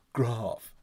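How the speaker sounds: "Graph" with a long British AH; Adelaide pronunciation. Shown as a demonstration. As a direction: say "Graph" with the A of "father". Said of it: "not graph".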